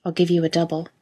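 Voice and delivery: plain reading voice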